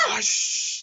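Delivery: high shrieking yell; loud scream. Real, not acted. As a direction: shriek then gasp. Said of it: "that's so loud".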